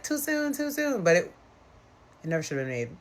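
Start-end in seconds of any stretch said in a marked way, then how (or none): 0.0-1.0 much higher, lightly voiced crowd; mock chorus. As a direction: tease them like the cast. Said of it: "They're like".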